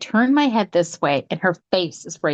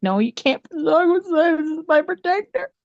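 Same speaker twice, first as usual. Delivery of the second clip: much higher, crying plea; pleading cry; 9 semitones higher than usual. Retold or imitated. Guest begging the family to keep her protector dog, crying plea. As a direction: beg through tears to keep the dog.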